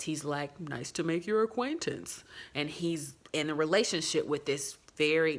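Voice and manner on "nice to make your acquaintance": lightly imitated oddly affected man; a hint of stilted, fake politeness; weird affect. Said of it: "has this really weird affect".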